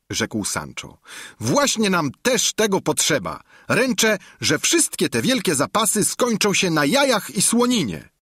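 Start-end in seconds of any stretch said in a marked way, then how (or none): 1.4-8.1 much higher squire voice; Sancho's voice. A narrator's reading. Sancho needling the innkeeper, much higher. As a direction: Sancho sure of himself.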